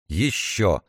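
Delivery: usual speaking voice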